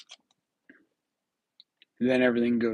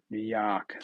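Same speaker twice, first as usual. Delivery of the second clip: New York accent; 3 dB quieter than usual. Shown as a demonstration. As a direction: drop the R in "York" like a New Yorker. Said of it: "Should say it like a New Yorker".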